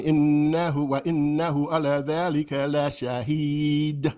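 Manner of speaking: recited slowly, the d of shahid bounced; tajweed recitation with a rattling final d; shaken final D. Shown as a demonstration. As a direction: give shahid a shaken d at the end. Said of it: "I'm shaking that D".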